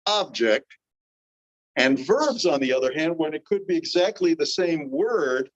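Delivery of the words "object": "object" as a noun, weight on OB; stress on first syllable. Shown as a demonstration. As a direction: say "object" as OB-ject, second syllable lighter. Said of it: "the emphasis on the first syllable".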